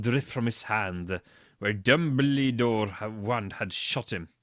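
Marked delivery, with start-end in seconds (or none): none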